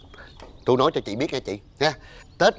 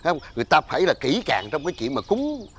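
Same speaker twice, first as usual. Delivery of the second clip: normal talking voice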